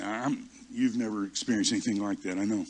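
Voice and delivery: normal talking voice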